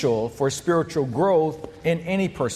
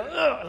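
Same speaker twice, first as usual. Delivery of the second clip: high, acted groan of a sore stomach; comic wincing "ugh"; pained groan; 9 semitones higher than usual. Shown as a demonstration. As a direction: groan as if hurting from a gut punch. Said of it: "my stomach is just hurting".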